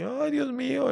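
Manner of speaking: high-pitched wail of a crying lion; mock crying. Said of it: "empezaba a llorar así".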